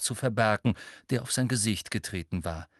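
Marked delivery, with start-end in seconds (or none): none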